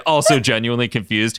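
ordinary delivery

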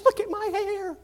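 very high-pitched mock wail; distraught bride. Bride to pastor about her hair, mock distraught. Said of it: "And so she was a wreck".

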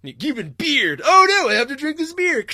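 high, loud hammed-up voices; mock game scene. Acting out beer version of the game, hammed-up. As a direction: play both friends over the top.

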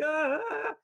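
imitated startled scream. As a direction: mimic a frightened shriek at moderate volume. Imitating startled man in film.

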